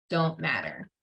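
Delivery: usual speaking voice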